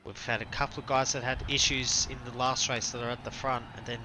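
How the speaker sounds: ordinary talk, nothing marked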